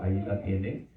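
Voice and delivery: usual speaking voice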